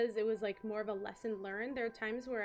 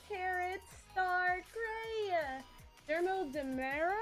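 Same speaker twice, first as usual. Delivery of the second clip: cute anime streamer; 6 semitones higher than usual. Acted. Thanking raiders by name.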